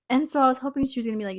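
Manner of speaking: ordinary talk, nothing marked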